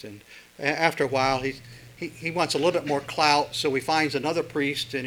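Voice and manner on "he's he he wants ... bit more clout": usual speaking voice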